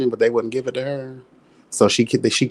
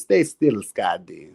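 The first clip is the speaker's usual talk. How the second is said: vampire trance voice; 5 semitones lower, 4 dB quieter, slower than usual. Pretend. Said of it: "I don't know why I gotta talk like that".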